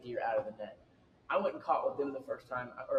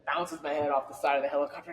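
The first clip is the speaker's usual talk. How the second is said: usual speaking voice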